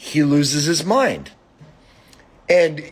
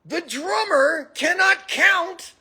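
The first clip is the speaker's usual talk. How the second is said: laughing exasperation; 13 semitones higher than usual. Real, not acted. Exasperated rant about the drummer.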